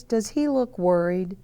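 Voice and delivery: ordinary delivery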